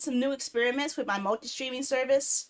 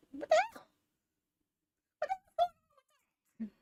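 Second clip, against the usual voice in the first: high squeaky what the hell, the second slow; shocked squeal; 4 dB quieter, much slower than usual. Real, not acted. Streamer finding old Threads notifications, squealing high in shock. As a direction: squeal it in disbelief, then stretch it out.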